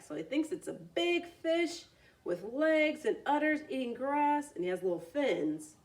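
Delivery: ordinary delivery, nothing marked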